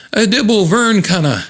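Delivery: high-pitched cartoon gibberish; frantic gibberish. Chrissy trying to explain what's going on, high gibberish. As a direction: blurt nonsense in a high character voice. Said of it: "Chrissy says".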